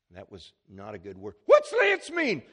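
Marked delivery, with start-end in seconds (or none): 1.5-2.4 put-on very high, loud child's shriek; panicked little boy. Son hearing doctor will lance his foot, panicked shriek. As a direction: shriek it like a frightened four-year-old. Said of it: "Michael says".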